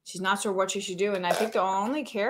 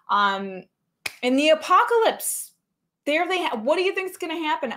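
dramatic announcer recap; 7 semitones higher, 5 dB louder than usual. Acted. Host wrapping up the apocalypse cast, theatrical announcer. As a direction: build the cliffhanger with big emphatic questions.

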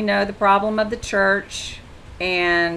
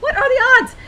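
much higher, squealing disbelief; amazed; 4 dB louder than usual. Real, not acted. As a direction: marvel at the coincidence. Amazed at the mileage match.

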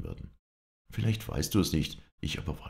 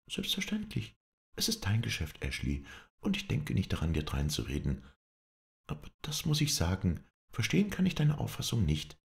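coolly offended, high and poised; cool injured innocence; 6 semitones higher than usual. Acted. Scarlett answers Ashley in front of guests, cool and hurt. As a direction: hide the hurt behind politeness. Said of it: "mit so viel Kühle und so viel gekränkter Unschuld".

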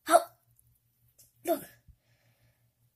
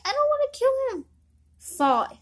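ordinary talk

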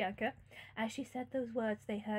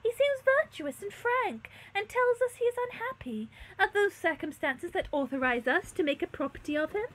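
high, loud noble indignation; stately theatrical questioning; reproving princess; 10 semitones higher, 9 dB louder than usual. A narrator's reading. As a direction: press the moral point on the maid. Princess rebukes maid.

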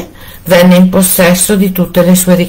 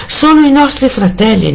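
hopeful wife; 9 semitones higher than usual. A narrator's reading. Wife hopes brothers come.

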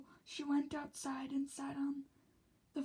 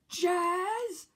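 high, much louder shout of the name; 4 semitones higher, 11 dB louder than usual. A narrator's reading. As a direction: yell her name in exasperation. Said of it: "Second Ruffles shouted".

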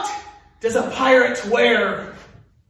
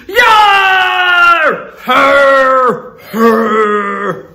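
loud gruff pirate cry; big hammed-up pirate shout; pirate yar; 8 dB louder, much slower than usual. Shown as a demonstration. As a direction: roar it like a pirate captain. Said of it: "That's my pirate yar".

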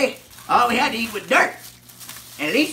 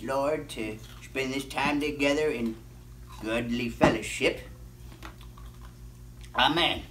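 quiet prayer; 8 semitones lower, 6 dB quieter than usual. Acted. Closing the grace.

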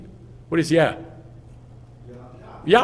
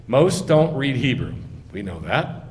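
normal talking voice

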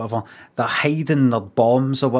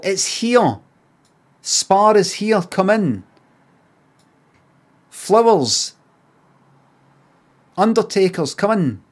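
higher, louder, beckoning like a shop sign; mock shop sign calling out; 7 semitones higher, 3 dB louder, slower than usual. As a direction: call customers in like a pushy sign. Voicing signs calling people in.

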